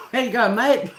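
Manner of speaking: hammed-up Australian greeting; Aussie greeting. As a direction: sing out a cheery Aussie hello to a half-remembered face. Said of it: "I say mate when I bump into someone".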